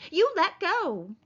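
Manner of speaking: high, drawn-out slow demand; crying out. A narrator's reading. Wife struggling to get free, crying out. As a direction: wail the order at him. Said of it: "she cried".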